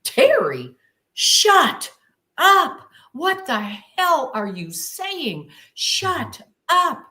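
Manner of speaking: high, loud sharp self-rebuke; scolding herself. Retold or imitated. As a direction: snap at yourself to stop. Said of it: "And I said to myself".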